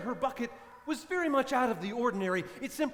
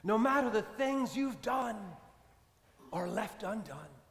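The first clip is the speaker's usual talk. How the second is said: closing sermon blessing. Acted. Preacher closes the sermon.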